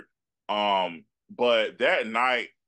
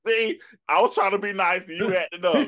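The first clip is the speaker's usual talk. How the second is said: higher, louder, through laughter; laughing mock scold; 8 semitones higher, 4 dB louder than usual. Real, not acted. Host teasing the guest for acting up, laughing. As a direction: scold playfully while cracking up.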